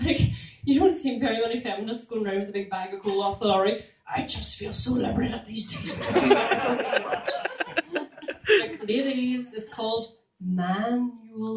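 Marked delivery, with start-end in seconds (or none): 4.1-7.3 breathy mock liberated. Mocking feminists hauling coal.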